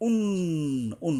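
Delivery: উন with a long nasal "nnn" hum; ghunna. Shown as a demonstration. Teacher shows the nun rule, উন hummed long. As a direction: hold the n of উন through the nose. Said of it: "নুনের উপরে গুণনা করতে হবে".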